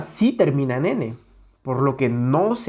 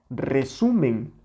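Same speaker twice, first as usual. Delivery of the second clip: resumen slowly, second syllable stretched; weight SU and let MEN fall in resumen; stress on SU; slower than usual. Shown as a demonstration. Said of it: "No es lo mismo resumen".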